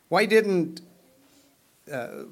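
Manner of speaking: normal talking voice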